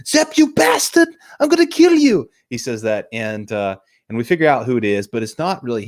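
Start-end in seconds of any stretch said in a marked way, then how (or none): 0.1-2.3 high, loud mock scream of rage; enraged imitation. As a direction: imitate a man screaming a death threat. Acts out Gordon's outburst at villain.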